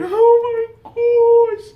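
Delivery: high, slow, choked-up cry; near tears. Real, not acted. Reading YouTube's letter, overcome and tearful. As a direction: voice breaking with emotion. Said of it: "Guys, I'm not crying".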